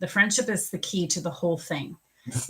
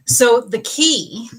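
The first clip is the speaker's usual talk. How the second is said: louder, slow, drawn-out So the key; slow emphatic announcement; 5 semitones higher, 9 dB louder, slower than usual. Real, not acted. Reader announcing the key to the viewer, slow and emphatic. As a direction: build up to the reveal. Dramatic lead-in.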